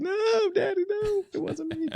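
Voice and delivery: put-on very high, pleading child's voice; scared kid pleading. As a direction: beg Daddy like a frightened kid. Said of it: "Like one of those kids that are getting beat".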